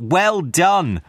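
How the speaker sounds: a hint of jovial Fezziwig cheering; hearty cry. A narrator's reading. Fezziwig praising the dancers, lightly voiced hearty cry. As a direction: call out the praise merrily. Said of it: "Old Fezziwig cried out".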